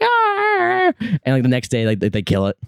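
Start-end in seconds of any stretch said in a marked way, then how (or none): 0.0-0.9 mock playful growl. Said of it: "playing around, like".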